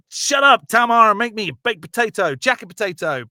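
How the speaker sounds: bullying husband shouting; angry shout. Acted. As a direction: yell at the wife to cook. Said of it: "I was like".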